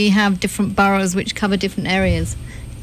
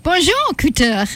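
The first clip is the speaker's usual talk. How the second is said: higher, lilting French hello; French accent, pitched up; French greeting; 5 semitones higher than usual. Real, not acted.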